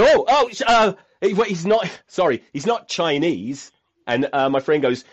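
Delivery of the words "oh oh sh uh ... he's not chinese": flustered apology. Retold or imitated. Panicked father apologizing.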